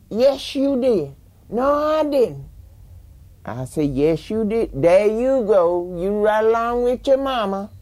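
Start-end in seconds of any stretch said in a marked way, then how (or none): 0.1-1.1 loud insisting. Retold or imitated. Mother insists in retold quarrel. 1.5-2.4 loud denial. Retold or imitated. Father denies in retold quarrel. 3.9-7.7 loud accusing. Retold or imitated. Daughter and father quarrel retold.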